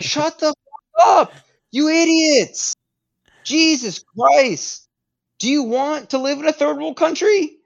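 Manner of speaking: very high-pitched, hammed-up presidential outburst; mock exasperated tirade. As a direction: curse out the public like a president losing it. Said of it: "I'd be cursing at everyone the entire time".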